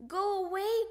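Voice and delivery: high, loud shooing; annoyed cry. A narrator's reading. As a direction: cry out to shoo a pest. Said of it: "cried Froggy".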